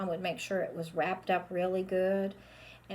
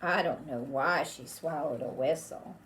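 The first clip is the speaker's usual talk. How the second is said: plain reading voice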